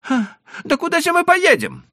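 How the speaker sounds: put-on high, pleading old merchant. A narrator's reading. Leiba asks.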